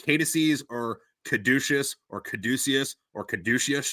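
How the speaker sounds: Caduceus slowly tried several ways; competing pronunciations. Shown as a demonstration. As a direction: say each Caduceus differently, as "KAY-tuh-seez" or "kuh-DOO-shus". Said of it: "on how if it's pronounced".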